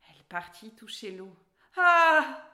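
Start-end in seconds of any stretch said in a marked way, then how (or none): 1.8-2.4 loud, high gasp of surprise; narrator's startled cry at the freezing water. A narrator's reading. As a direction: jolt at the cold. Touching icy water.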